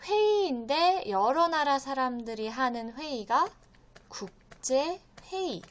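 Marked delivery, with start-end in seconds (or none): 4.1-5.6 slow, drawn-out 국제 회의; slow vocabulary modelling. Shown as a demonstration. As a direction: say 국제 회의 word by word for learners. Teacher models a new term.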